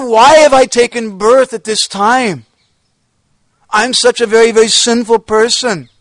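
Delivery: high, loud, anguished crying out to the lost saints; retold wailing lament. Teller voices the saint crying out for departed devotees, loud anguished wail. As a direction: plead into the emptiness, voice straining with grief. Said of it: "So crying and crying like this".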